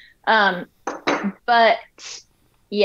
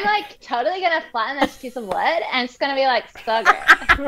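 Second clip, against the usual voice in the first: put-on high, bubbly valley girl; 7 semitones higher than usual. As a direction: gush like a ditzy valley girl. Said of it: "it has a Valley Girl voice".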